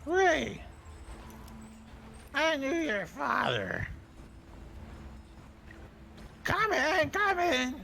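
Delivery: through a door. Acted. Lion knocker recognizes visitor.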